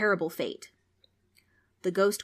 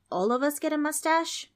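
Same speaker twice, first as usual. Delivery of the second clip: put-on hopeful kid; 9 semitones higher, 4 dB louder than usual. Darren asks hopefully.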